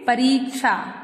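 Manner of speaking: "परीक्षा" with a long "ree"; correct pronunciation. Shown as a demonstration. Teacher giving the right word, correctly pronounced. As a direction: stretch the i in "परीक्षा": pa-reek-sha. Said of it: "इसको शुद्ध कैसे लिखेंगे?".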